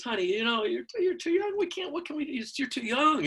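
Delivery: high-pitched imitation of a hesitant mother; fretting mom. Mom telling her son he is too young, fretful. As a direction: stammer worriedly, way up high.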